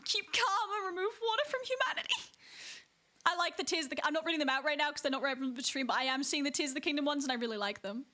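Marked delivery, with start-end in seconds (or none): 0.1-2.1 high wailing meme read; mock crying.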